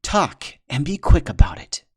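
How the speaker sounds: stern order. Acted. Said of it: "said Fishtail".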